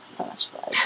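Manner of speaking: breathless eerie whisper; spooky whisper. Acted. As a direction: whisper it like a ghost.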